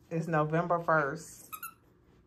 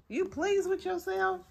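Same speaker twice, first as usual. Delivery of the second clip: much higher, sing-song mock praise to the dog; 14 semitones higher than usual. Real, not acted. Owner teasing the dog over the wrecked toy, high sarcastic baby talk. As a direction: squeak it up like talking to a naughty puppy.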